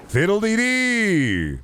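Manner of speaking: very high, stretched-out wail; catchphrase bit. Acted. Streamer hamming up a catchphrase, very high drawn-out catchphrase. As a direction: hold it up high, then slide down. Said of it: "And I be going".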